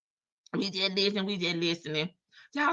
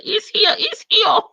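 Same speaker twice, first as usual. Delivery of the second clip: high, loud and thrilled; excited find; 12 semitones higher, 8 dB louder than usual. Real, not acted. Streamer finding the screenshot for chat, excited. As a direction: burst out that you found it.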